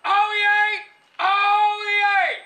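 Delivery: high ringing proclamation shout; town crier. Shown as a demonstration. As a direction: cry it out loud to the whole square. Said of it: "I'm the town crier".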